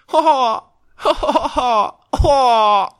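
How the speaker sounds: series of high, loud "ha ha" bursts; Ash's laugh. Retold or imitated. Host doing an Ash impression for friends, high mock laughing. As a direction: imitate a cartoon hero laughing, drawn out. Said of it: "That's my Ash impression".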